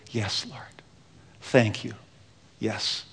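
hushed, unvoiced whisper of thanks; a reverent whisper to the Lord; whispered prayer. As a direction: breathe it like a private prayer. A believer answering in prayer.

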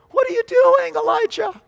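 very high, squeaky incredulous voice; mock-astonished falsetto cry; falsetto questioning. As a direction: leap into falsetto to question him. God's question replayed in falsetto.

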